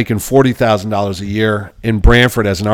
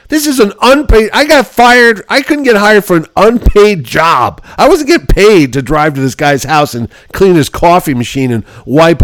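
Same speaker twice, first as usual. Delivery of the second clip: indignant rant; 5 semitones higher than usual. Retold or imitated. Complaining to his advisor, higher indignant outburst. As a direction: vent the injustice.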